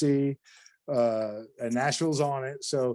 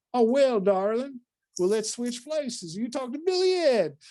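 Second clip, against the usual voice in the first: put-on Southern lady accent; 10 semitones higher than usual. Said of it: "my accents are horrible".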